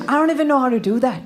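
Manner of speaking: quick, put-on British accent. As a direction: rattle it off in mock British. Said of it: "speak with a British accent".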